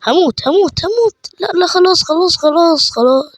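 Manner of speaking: real panic, pitch soaring high; high frightened gamer yelps begging it to stop; panicked squeals. Real, not acted. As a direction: shriek it out as if about to die. Enemy attacking, gamer panics.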